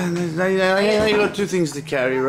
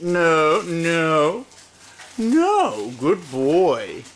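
ordinary talk, nothing marked

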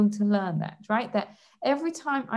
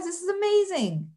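delighted; 8 semitones higher than usual. Retold or imitated. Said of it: "I realize".

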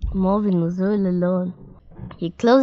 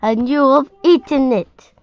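angry; 5 semitones higher, 5 dB louder than usual. A narrator's reading. Angry Marvin accuses Molly.

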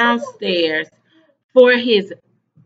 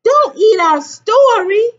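high, loud protest; children wailing a complaint together; crying out; 9 semitones higher, 7 dB louder than usual. A narrator's reading. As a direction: cry out against a naughty classmate. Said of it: "cried the children".

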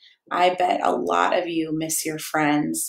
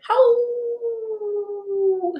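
long high howl like a singing dog; high drawn-out doggy howl. Pretend. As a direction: throw your head back and howl. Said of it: "what it sounds like when a dog sings".

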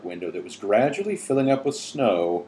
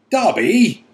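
loud, high, startled yell; 10 semitones higher, 9 dB louder than usual. A narrator's reading. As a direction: yell the name out in shock. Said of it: "he yelled".

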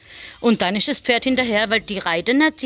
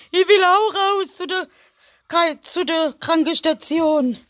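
high put-on little animal voice, slower at the end; rabbit's voice; 7 semitones higher, 3 dB louder, slower than usual. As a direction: speak as the rabbit wanting out. Spricht für einen Hasen. (mit hoher Hasenstimme)

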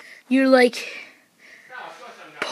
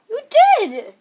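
ordinary talk

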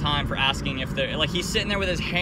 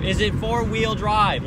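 slow, loud, higher mock question; retold oblivious repeat; 6 semitones higher, 5 dB louder, slower than usual. Old clerk asking once more, not listening, slowly oblivious. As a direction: drag out the repeated question, mocking him. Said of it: "he just kept not listening to me".

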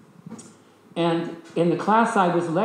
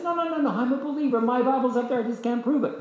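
high-pitched hurried protest; eager protest; 9 semitones higher than usual. Retold or imitated. Man assures women on plane he's a believer, high eager protest. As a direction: insist you're a believer, pitched up.

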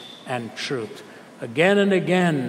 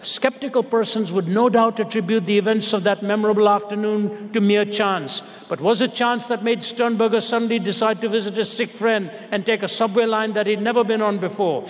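raised, ringing reading of the article; dramatic reading; 6 semitones higher than usual. Acted. Preacher reading the article's ending to the congregation, high and building. Reads the article's closing lines.

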